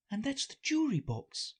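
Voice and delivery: put-on high, girlish inner voice; Alice thinking. Alice to herself in court, high musing voice. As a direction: think aloud in a high little-girl voice. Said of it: "thought Alice".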